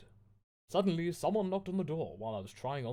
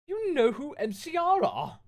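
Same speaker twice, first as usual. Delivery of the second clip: much higher, louder breathless squeal; overplayed fangirl disbelief; shocked squeal at Dumbledore; gasped in shock; 9 dB louder than usual. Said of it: "I gasped".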